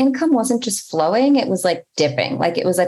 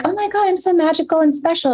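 much higher, gushing mock awe; mock self-amazement; 10 semitones higher, faster than usual. Mocking herself after money arrives, higher gushing awe. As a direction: gush over yourself, tongue in cheek. Mocking her own rescue high.

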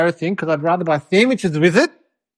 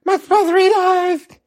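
mock outraged mum; 11 semitones higher, much slower than usual. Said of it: "And Mum was like".

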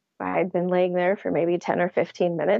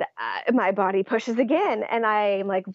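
normal talking voice

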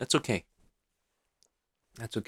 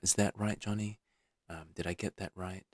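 gentle reassuring dad; soft, gentle tone; 10 dB quieter than usual. Acted. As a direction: invite him to open up, quietly. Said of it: "soften your voice, soften your tone".

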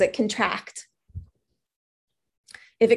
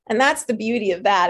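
usual speaking voice

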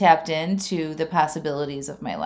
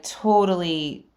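ordinary delivery

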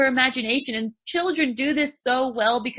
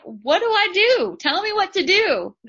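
high, loud pleading cry for help; begging to be told what to do; 9 semitones higher, 5 dB louder than usual. Retold or imitated. Coach retelling her plea to her mentor, high and loud. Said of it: "And I am like".

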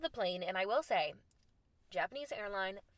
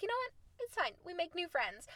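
much higher, light sing-song resignation; resigned shrug; 9 semitones higher, 5 dB quieter, faster than usual. Retold or imitated. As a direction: brush it off in a high, airy voice. Shrugging off the Facebook add.

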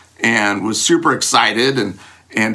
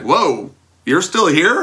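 slow, higher amazed question; surprised girlfriend; 6 semitones higher, slower than usual. Retold or imitated. Girlfriend finding him still outside, slow surprised question. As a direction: sound caught off guard to find him there.

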